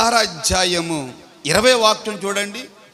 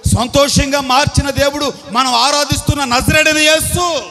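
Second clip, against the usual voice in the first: raised, ringing declaration; fervent proclamation; 5 semitones higher, 5 dB louder than usual. Real, not acted. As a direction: lift the voice to proclaim the name. Climax proclaiming the name of Jesus. (ఆవేశంగా, స్వరం పెంచి)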